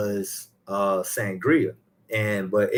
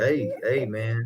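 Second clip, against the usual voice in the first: usual speaking voice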